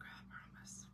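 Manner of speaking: soft whispered reassurance. Real, not acted. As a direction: whisper it to yourself, hushed.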